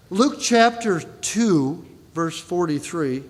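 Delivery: drawn out slowly; slow announcement. Real, not acted. Preacher announcing the scripture passage, slow. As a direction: announce the reference deliberately.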